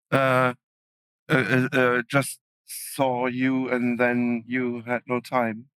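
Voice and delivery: normal talking voice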